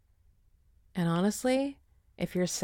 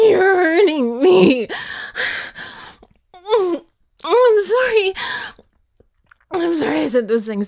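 crying out; 8 semitones higher, 10 dB louder than usual. Acted. Pleading while being hurt.

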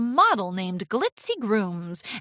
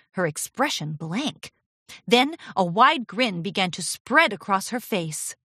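ordinary delivery, nothing marked

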